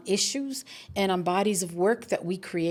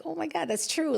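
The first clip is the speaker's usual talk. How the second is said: jumps high in pitch, amazed; imitating a friend's dawning surprise; sudden realization; 10 semitones higher, 4 dB quieter than usual. Retelling a colleague's sudden realization of the truth, with a high surprised voice.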